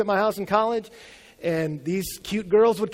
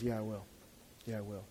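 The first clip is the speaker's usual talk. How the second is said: soft acceptance; 9 semitones lower, 17 dB quieter, faster than usual. Acted. Rebekah agreeing to go.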